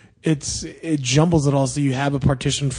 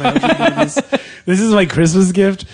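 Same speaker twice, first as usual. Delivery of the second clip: mock outrage; 5 semitones higher, 5 dB louder than usual. Mock outrage at gift idea.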